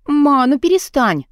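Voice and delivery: high, slow plea to stop; pleading cry. A narrator's reading. Daughter begging her mother to stop, high and drawn out. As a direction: beg mom to stop, drawn out.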